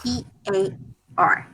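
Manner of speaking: ordinary talk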